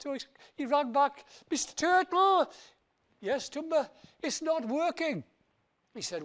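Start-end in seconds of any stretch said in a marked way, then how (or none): 1.5-5.2 whiny Tumba. Acted. Tumba whining again.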